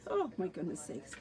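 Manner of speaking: ordinary talk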